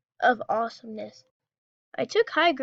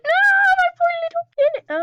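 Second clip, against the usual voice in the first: mock wail; 8 dB louder, faster than usual. Real, not acted. Cannon destroyed, wailing.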